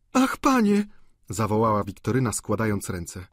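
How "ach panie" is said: high, louder cry of alarm; alarmed girl. A narrator's reading. Wiktoryna crying out to Vautrin in alarm. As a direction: gasp it out like a frightened girl.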